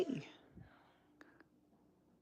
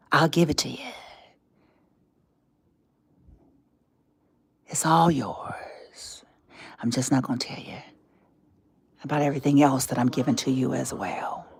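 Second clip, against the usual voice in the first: low, soft gloating that sinks into a whisper; sinister whisper; 12 semitones lower, 8 dB quieter, faster than usual. Acted. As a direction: drop to a secret, sly hush. Tempter hides the hidden cost.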